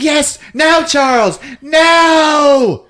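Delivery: high, loud, gleeful commands; mock villainous mom. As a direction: urge on a minion, hammed up. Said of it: "the mom is just like".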